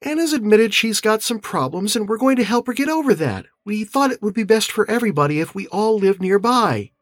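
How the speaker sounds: high female character voice; narrator's Beth, conciliatory. A narrator's reading. Beth speaks of helping her sister-in-law, high-voiced. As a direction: explain it kindly in a high-pitched voice.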